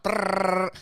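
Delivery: fizzing noise. Retold or imitated. Imitates the fizzing sound.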